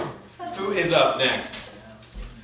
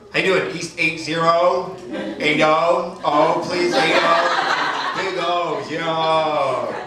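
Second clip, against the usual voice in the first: lightly put-on New York cabbie talk, a touch louder; mock New York accent; 5 dB louder, much slower than usual. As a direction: correct the driver like a native New Yorker. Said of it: "you're always like".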